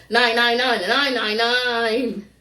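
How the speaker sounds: sing-song the lawyer's TV number; ad jingle. Retold or imitated. Quoting the lawyer's TV ad number, slow jingle style.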